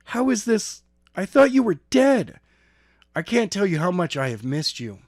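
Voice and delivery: high, loud disbelief rising to a cry; near tears. A narrator's reading. Skylar on the phone to lost friend, high and loud. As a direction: blurt it out, overwhelmed. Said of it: "with tears forming in his eyes".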